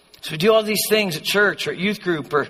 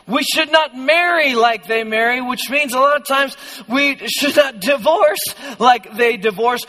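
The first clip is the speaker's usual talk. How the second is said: normal talking voice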